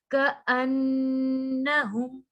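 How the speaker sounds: slow, drawn-out tajweed recitation; Quran recitation. Shown as a demonstration. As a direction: recite it with ghunna on the noon. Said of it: "नून पे गुन्ना तो करना है". (धीमी तजवीद वाली तिलावत)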